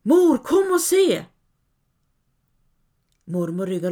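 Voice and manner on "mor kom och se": very high, loud, slow scream; frightened scream. A narrator's reading. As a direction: scream for help in fear. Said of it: "innan hon skriker". (högt, rädd skrik)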